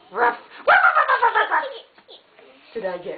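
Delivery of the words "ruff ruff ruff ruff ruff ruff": puppy bark. Pretend. Dog puppet chasing a child, higher playful barks.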